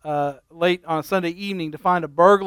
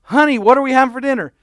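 calling out; 6 semitones higher, 4 dB louder, faster than usual. Retold or imitated. Husband right behind her.

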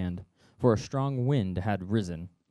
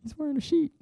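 very high, goofy mock fright; silly disciple voice. Disciples mistaking Jesus for a sheet ghost, very high and silly. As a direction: squeak it like a cartoon scaredy-cat. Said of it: "they're like".

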